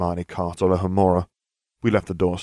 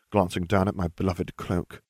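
plain reading voice

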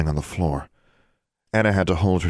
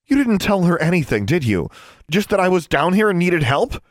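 high, loud, angry challenge; rising anger; 13 semitones higher, 5 dB louder than usual. A narrator's reading. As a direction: demand it angrily, voice raised. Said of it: "Her voice rose angrily".

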